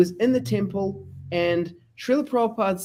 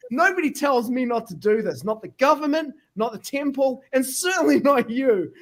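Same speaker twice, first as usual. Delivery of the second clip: mock angry defiance; 7 semitones higher than usual. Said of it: "I was so angry by that stage".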